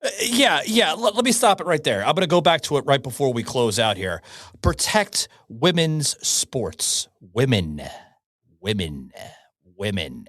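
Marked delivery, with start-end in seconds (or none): 4.6-7.1 drawn out, stress on women's; slow emphatic slogan. Real, not acted. Host hammering the slogan home, slow and emphatic. 7.2-10.3 low, soft, deliberate repeats of "Women"; repeated for emphasis. Real, not acted. Host repeating the key word to the audience, low and deliberate. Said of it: "Let that terminology sink in".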